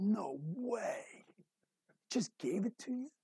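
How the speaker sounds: awed whisper, rising; breathless boyish wonder; amazed kid. Retold or imitated. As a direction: whisper it like a wide-eyed kid. Kid amazed by the new car.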